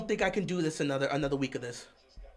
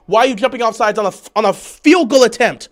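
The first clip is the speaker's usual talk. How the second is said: quick, loud complaint; exasperated question; 3 semitones higher, 10 dB louder than usual. Real, not acted. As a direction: fire the question out loudly. First take of angry question.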